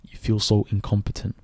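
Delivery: normal talking voice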